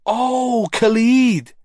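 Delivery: high, drawn-out mock surprise; mock impressed. A narrator's reading. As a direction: pretend to be impressed. Jono mocks the name.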